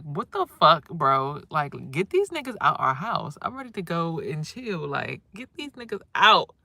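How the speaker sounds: fed up, wants them gone; imitating the irritated son; annoyed son. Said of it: "My son is like".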